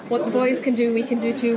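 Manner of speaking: ordinary talk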